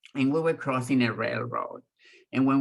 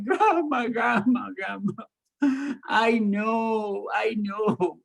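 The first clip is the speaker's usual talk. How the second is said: put-on upset child; 8 semitones higher, slower than usual. Child pleading to grandma.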